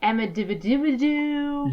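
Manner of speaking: Amadividibidoo as run-on nonsense syllables; garbled Amityville turning into Amadividibidoo; tongue-tripping garble. Real, not acted. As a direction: babble Amadividibidoo past the real name. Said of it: "So I've called it".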